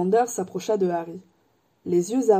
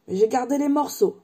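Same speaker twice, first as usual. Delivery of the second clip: more assured; 5 semitones higher than usual. Acted. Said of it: "d'une voix plus assurée".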